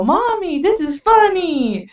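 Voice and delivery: put-on high, slow little boy voice. Mom imitates her son delighted with the toy, high small child voice. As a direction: play a small child, amused and innocent. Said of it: "turn it on and go".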